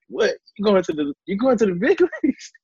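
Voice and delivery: high-pitched, disbelieving squeal; retold excited yelp, rushing and cracking into laughter; imitated dad's scream. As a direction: shoot the pitch up like a stunned dad. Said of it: "he screams".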